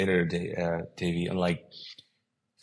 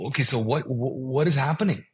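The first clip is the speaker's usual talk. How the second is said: higher, stumbling confusion; baffled question, voice lifted; bewildered; 6 semitones higher than usual. Retold or imitated. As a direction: retell being thrown and lost for words. Retelling his bewildered reaction.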